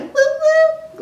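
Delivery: "whoop, whoop" squealed high and loud; mock whoop. Vlogger hyping her foundation, squealing whoops. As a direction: cheer it out like a party horn. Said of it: "really, like".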